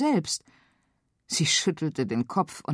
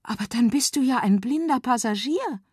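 put-on girl voice; 8 semitones higher than usual. A narrator's reading. Feli speaks.

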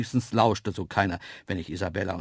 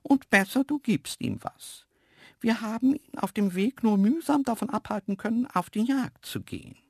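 very high cartoon antelope voice; Isabella; 12 semitones higher than usual. Antelope urging human to feed Poldi, high warning voice. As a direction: warn in a high animal voice. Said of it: "übersetzte Isabella".

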